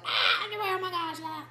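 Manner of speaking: high, squealing mock-outraged kids; mock horrified class of kids; kids shocked. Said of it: "they're like".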